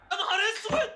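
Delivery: sudden very high shriek; shocked squeal. Real, not acted. Fan shocked by the play, high shocked squeal. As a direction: yelp it out in shock.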